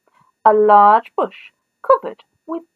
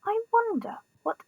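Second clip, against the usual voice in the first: usual speaking voice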